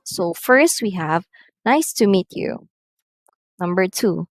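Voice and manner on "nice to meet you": statement falling on "you"; falling intonation. Shown as a demonstration. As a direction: let "Nice to meet you" drop at the end. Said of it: "usually using falling intonation".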